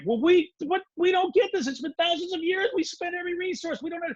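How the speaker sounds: high-pitched mock exasperation; baffled protest. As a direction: voice the bewildered crowd, pitched well up. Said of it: "And they're like".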